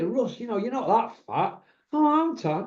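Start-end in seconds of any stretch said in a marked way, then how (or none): 1.9-2.7 high, hopeful little voice; mock hopeful. Boxer retelling flatterers, high hopeful voice. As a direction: act surprised and pleased. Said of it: "mocked flatterers dialogue".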